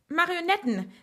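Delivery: high, triumphant exclamation; exultant call, pitch well up; triumphant cry. A narrator's reading. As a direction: announce the solution in triumph. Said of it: "rief sie triumphierend aus".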